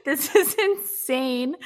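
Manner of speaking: high, slow, astonished laugh; floored and giggling; laughing disbelief. Real, not acted. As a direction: stretch it out in stunned amusement. Stunned on learning she was wrong.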